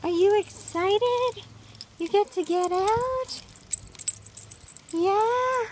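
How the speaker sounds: high sing-song voice for the dog. Real, not acted. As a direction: coo up high at the pup. Excited baby talk to dog.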